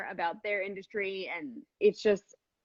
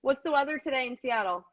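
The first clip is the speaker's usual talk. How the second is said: talking to voice assistant; 6 semitones higher, 3 dB louder than usual. Real, not acted. Commands the Google Home device.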